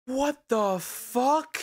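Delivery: slow, high-pitched shock; stunned disbelief. Real, not acted. Streamer reacting to an appalling video, stunned disbelief. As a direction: draw it out high, completely appalled.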